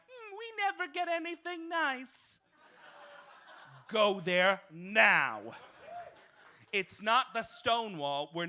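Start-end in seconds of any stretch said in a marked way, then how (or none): 0.1-2.4 high mock complaint, mimicking; high whiny imitation of a complainer; mocking whine. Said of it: "and then you're all like". 3.9-5.4 slow, loud, each word punched; slow emphatic order; firm command. Real, not acted. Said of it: "command to audience".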